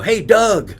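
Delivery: high, loud call to a buddy. Retold or imitated. Pretending to call a buddy, loud high call. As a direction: call out to Doug across the lot. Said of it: "real loud".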